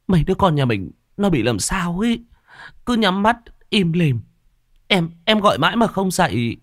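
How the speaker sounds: high, crying voice of a frightened mother; tearful whimper. A narrator's reading. Mother tells her husband the children won't wake, tearful. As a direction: sob the words out in panic. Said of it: "Thị Lam lức lờ".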